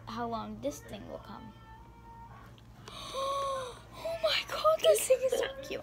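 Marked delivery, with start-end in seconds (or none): 2.9-4.0 high, drawn-out gasp; sharp breath of delight at something cute. Real, not acted. Child gasping at the new toy, delighted. Reacting to new toy's cuteness. 4.0-5.8 high-pitched squeal of delight; excited gushing. Real, not acted. Child showing off the new toy, excited gushing.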